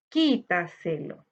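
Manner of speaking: teacher splitting "Quítaselo" into syllables. Shown as a demonstration. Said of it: "debemos separar por sílaba". ("Quítaselo" más grave, sílaba por sílaba)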